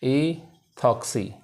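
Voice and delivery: "ईथॉक्सी" slowly, in two drawn-out parts; slow syllable split. Shown as a demonstration. Teacher answering own question for students, "ईथॉक्सी" slowly in separated parts. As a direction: say "ईथॉक्सी" stretched, with a break inside. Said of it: "क्या हो जाएगा".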